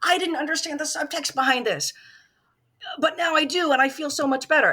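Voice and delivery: high, bright delighted realisation; a hammed-up aha moment; mock revelation. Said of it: "you're not gonna have a point of going".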